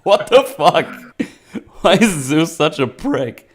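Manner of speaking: high, loud what the fuck; loud outraged disbelief. Real, not acted. Reacting to the film's Zeus, loud shocked outrage. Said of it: "I was like".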